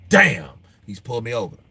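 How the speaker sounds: usual speaking voice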